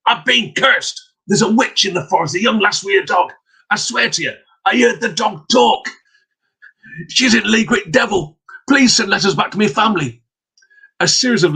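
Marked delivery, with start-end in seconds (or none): 0.1-10.2 high, agitated mock shout; shouting peddler. Cursed peddler raving at townsfolk, high agitated. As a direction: cry out that you've been cursed. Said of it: "he shouted".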